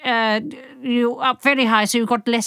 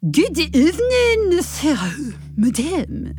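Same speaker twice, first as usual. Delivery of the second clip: Swiss accent; 5 semitones higher, slower than usual. Shown as a demonstration. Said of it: "I should do a Swiss accent here".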